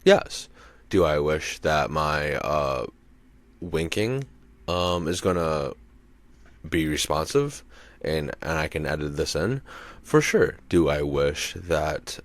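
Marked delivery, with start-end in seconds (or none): 0.0-0.5 much higher and louder, with emphasis; emphatic answer. Real, not acted. To his own rhetorical question, an emphatic much higher and louder answer. 10.0-10.6 much higher and louder, with emphasis; emphatic answer. Real, not acted. To his own rhetorical question, an emphatic much higher and louder answer.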